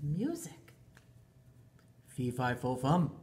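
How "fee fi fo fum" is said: sleepy giant mutter. Acted. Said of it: "muttered the giant in his sleep".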